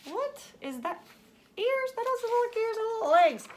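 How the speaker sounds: high, mock-confused kid voice; puzzled kids' voice. Teacher voicing the class's confused guess, high. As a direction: imitate the puzzled children. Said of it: "we were like".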